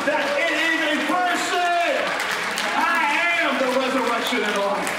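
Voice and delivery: rousing pulpit proclamation; full-force preaching. Real, not acted. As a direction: build to a shouted sermon climax. Preaches at full force, building to shout.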